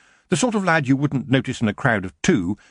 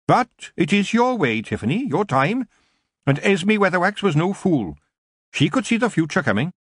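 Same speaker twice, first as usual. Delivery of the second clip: higher, measured elder witch; Mrs Proust, approving; 9 semitones higher than usual. A narrator's reading. Older witch backs the young witch's decision, approving. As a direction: lightly voice an older witch giving her backing.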